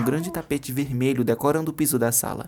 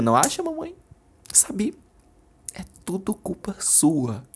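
bitter; 7 semitones higher than usual. Acted. Bitter accusation.

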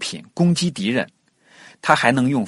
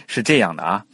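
ordinary talk, nothing marked

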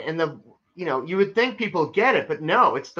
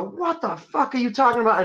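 higher, sharp retort half-voiced; mock angry yell; 7 semitones higher than usual. Retold comeback to a twitchy Scientology tester, mock angry. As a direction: snap back in mock outrage. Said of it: "I was about to go".

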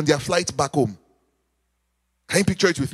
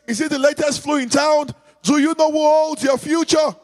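raised, high preacher's call; preaching; 6 semitones higher than usual. Acted. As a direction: build it up, calling out to the church. Preacher building to a shout.

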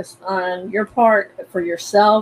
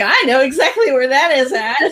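put-on Southern accent; 4 semitones higher, 4 dB louder than usual. Host playfully mimicking a Southern accent about Duke. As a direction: put on a hint of a North Carolina Southern accent. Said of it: "you know the Southern, uh, speech, right?".

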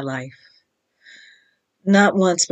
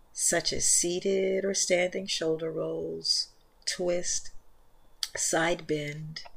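soft, unhurried meditation voice, much softer than the intro; guided meditation; 11 dB quieter than usual. Acted. Leads guided yin yoga meditation.

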